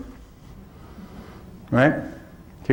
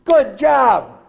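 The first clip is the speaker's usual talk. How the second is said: mock cheerful praise; 8 semitones higher than usual. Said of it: "It's like".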